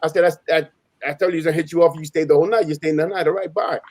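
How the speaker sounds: ordinary talk, nothing marked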